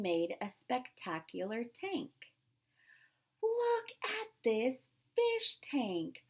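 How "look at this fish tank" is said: high, slow amazement; amazed. Real, not acted. Reader showing kids the tank, high and amazed. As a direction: marvel at the picture. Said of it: "reader marvels at picture".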